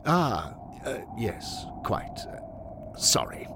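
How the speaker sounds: halting, fading, half-whispered apology; sheepish apology. Acted. Henry is rebuked by the Welshman, sheepish apology. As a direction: back down awkwardly, hesitating.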